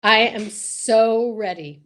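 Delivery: usual speaking voice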